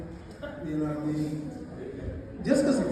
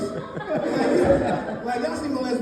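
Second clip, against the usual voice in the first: laughing; 4 semitones higher, much slower than usual. Real, not acted.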